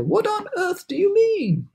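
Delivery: very high incredulous question; put-on chorus of bafflement; cried in disbelief. A narrator's reading. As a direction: demand it in an astonished high voice. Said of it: "they cried".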